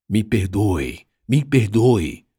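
understated cry for forgiveness; plead, building a little on the repeat; mild anguished cry. A narrator's reading. Remorseful father begging his daughter's forgiveness, mild anguished cry.

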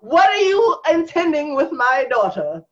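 high mock-stern father voice; hammed-up protective dad; gruff overprotective dad.

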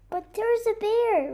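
usual speaking voice

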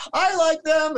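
high, loud mocking voice; squeaky mock of her saying it; mock Abramovich quote. Mocking the artist's squeaky reply. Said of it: "She comes out like".

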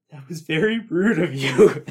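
laughing, high fake reproach; a father's pretend telling-off, giggling; mock scolding through laughter. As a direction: scold him while cracking up. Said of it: "And he's like".

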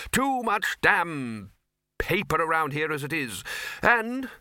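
blustering Arch-Chancellor. Acted. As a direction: scold a subordinate with impatient bluster. Arch-Chancellor grumbles at paperwork.

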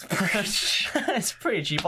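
slow, high, breezy mock line; mock casual girlfriend. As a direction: lightly voice her shrugging it off. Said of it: "She's like".

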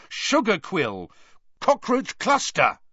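1 yelling passwords. A narrator's reading.

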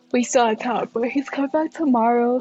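talking through a yawn. Real, not acted. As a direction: yawn while speaking. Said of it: "Sorry for all the yawning".

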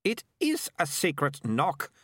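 high-pitched, irritated insistence; indignant girl, slow and emphatic; snapped. A narrator's reading. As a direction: snap back, leaning on each word. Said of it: "Melicia snapped".

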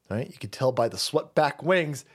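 normal talking voice

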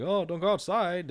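mock the radio warning; radio voice taunting him; trolling radio.

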